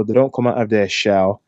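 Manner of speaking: normal talking voice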